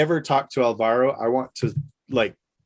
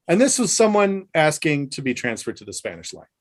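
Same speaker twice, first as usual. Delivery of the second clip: normal talking voice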